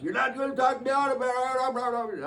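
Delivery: put-on high, slow, defiant old man; a lightly hammed defiant old man; voiced old man. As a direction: play the stubborn old man refusing help. Old man voiced in retold story.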